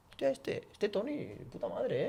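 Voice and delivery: delighted buyer, pitched high; impressed client. Retold or imitated. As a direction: gush like a won-over client. Impressed client thinking about Tony.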